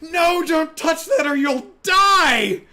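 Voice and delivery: very high, full-volume mock scream; hammed-up high-pitched screamed warning; panicked yell. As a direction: shriek a frantic warning, stretching the words. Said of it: "yells at him".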